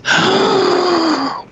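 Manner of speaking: sharp gasp of shock; a high, sharp gasp of panic. Retold or imitated. Panic attack flying over LA.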